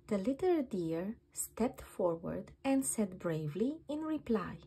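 plain reading voice

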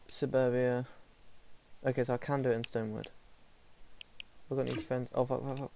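ordinary talk

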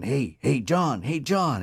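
a high, drawn-out call of his name; the dead friend hailing John from outside the car window, calling out. Retold or imitated. High call of John's name rising on each repeat.

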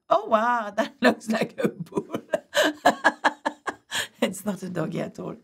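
laughing at a drawing gone wrong; real laughter. Real, not acted. Teacher laughing at her dog drawing that looks like a bull, real laughter. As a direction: laugh through the words, amused at yourself.

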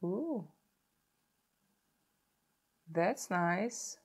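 ordinary delivery

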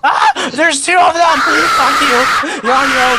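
panicked yells, high and loud; scared shouting. Real, not acted. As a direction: shout in real fright. Chased in horror game.

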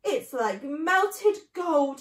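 halting, drawn-out rapture; gasping wonder. Acted. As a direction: gasp it out, overcome with bliss. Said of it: "he gasped".

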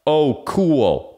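lightly hammed-up pointed reply; all-caps text. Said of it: "I text him back in all caps".

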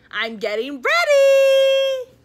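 high, loud, drawn-out shout; calling back. A narrator's reading. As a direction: holler back to Dad in another room. Froggy calling back to his father.

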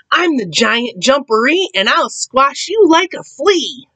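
high-pitched booming boast from the burrow; loud giant voice. A narrator's reading. Hidden Giant Jumperee warning Rabbit, loud voice. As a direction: put on a big scary voice, pitched high. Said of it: "she heard a loud voice".

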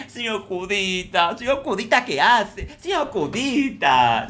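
aguda y lenta, empalagosa; llama al señor con dulzura fingida; imitando a Rosita. Retold or imitated. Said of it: "Es muy". (Rosita empalagosa imitada)

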